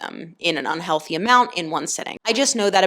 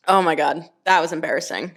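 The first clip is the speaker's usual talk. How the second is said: normal talking voice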